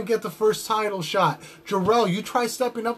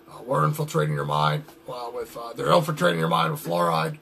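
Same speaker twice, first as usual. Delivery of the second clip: Alex Jones impression; 4 semitones lower than usual. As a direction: lower conspiracy-radio rant voice, stammering with uh. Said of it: "there's Alex Jones from InfoWars".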